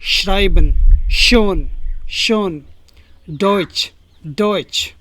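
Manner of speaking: श्राइबन, शोन with a clear opening "sh"; German sch as "sh". Shown as a demonstration. Teacher drills sch examples, "sh" in श्राइबन, शोन, डॉइच. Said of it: "इसका sound निकलता है श".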